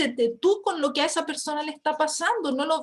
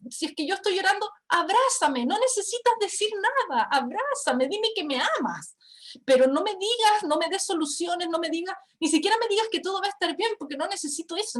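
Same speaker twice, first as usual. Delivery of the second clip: higher-pitched, insistent retold plea; 7 semitones higher than usual. Crying wife asks husband for a hug, insistent plea. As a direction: plead urgently for a hug, retelling it.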